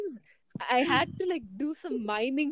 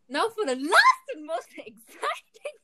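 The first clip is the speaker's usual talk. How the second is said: higher-pitched, over-the-top announcer hype; hyped announcement; 6 semitones higher than usual. Pretend. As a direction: build up the last item with mock excitement.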